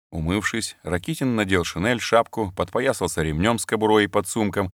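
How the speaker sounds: ordinary delivery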